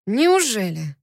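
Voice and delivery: lightly voiced sarcastic man; a dry sarcastic jab. A narrator's reading. Captor doubts her claim, sarcastically. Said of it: "съязвил он".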